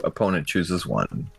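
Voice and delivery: usual speaking voice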